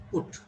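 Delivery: "put" with a brief u vowel; short U. Shown as a demonstration. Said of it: "This U is short U".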